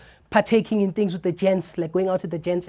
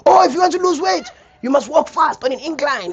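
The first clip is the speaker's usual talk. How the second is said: much higher, louder know-it-all advice; gym guy advice; 8 semitones higher, 7 dB louder than usual. Retold or imitated. Gym regular advising the fat guy, high and loud. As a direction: lecture like a keen gym regular.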